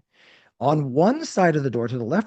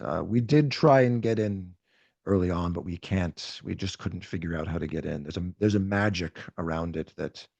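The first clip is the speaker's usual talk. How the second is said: grave Rat King; 6 semitones lower, 3 dB quieter than usual. Acted. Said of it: "He's like".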